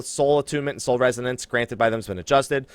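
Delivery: usual speaking voice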